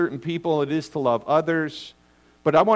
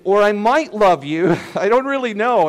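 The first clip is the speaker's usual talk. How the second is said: hammed-up doubtful vow; 4 semitones higher, 6 dB louder than usual. Mock bride's vow, hammed up.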